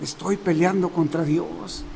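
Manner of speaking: soft and slow, awed; quiet realization. Retold or imitated. Paul realizing he fights God, softly and slowly. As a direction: let the words sink in slowly and softly. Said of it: "se acordó de sus palabras de su maestro y dijo".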